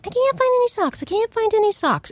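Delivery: put-on high whining kid voice; whiny kids. Mimicking kids whining about missing socks.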